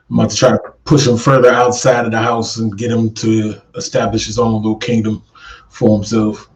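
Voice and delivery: usual speaking voice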